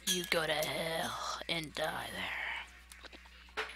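slow, soft, breathy whisper of a curse; real grumbling whisper at the game; muttered curse. Real, not acted. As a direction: hiss it under your breath, very slow. Cursing the game.

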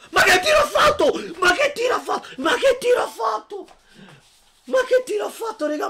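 high, loud, laughing disbelief; amazed shouting. Real, not acted. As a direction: scream it in amazement. Amazed after a stunning goal.

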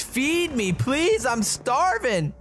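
high, drawn-out excited begging; gloating mock plea. Real, not acted.

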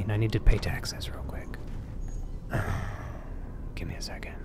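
unvoiced hushed whisper. Real, not acted. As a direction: whisper it like you're hiding. In the outhouse, hushed.

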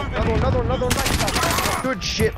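high, hyped-up cheering; excited cheering. Real, not acted. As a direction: cheer a teammate on mid-fight. Cheering on teammate's kills.

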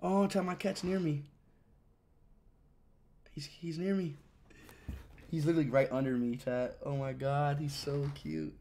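soft, low doting voice; hushed and fond. Real, not acted. Streamer telling chat his cat is near, hushed. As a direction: keep it very soft, as if not to startle a pet.

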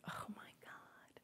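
breathy whispered aside. Real, not acted. To the group, a whispered oh my God aside. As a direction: drop to a bare whisper. Aside after "you're scattered.

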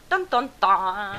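slow loud dun dun dun sting; mock dramatic sting. Player greeting old enemies, mock suspense sting. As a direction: hum a spooky reveal. Ominous music mimicked.

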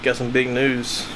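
usual speaking voice

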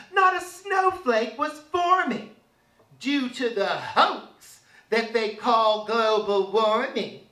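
usual speaking voice

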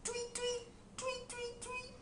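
very high, soft tweeting; bird tweet. Pretend. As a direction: chirp like little birds. Bird sound.

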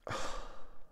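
very soft exhale; overwhelmed sigh. Real, not acted. Collector overwhelmed by his new figure, soft sigh. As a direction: breathe out a quiet, awed sigh.

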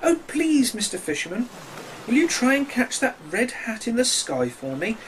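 high, pleading little fish. A narrator's reading. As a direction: beg the fisherman in a small high voice. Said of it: "looked out of the water and said".

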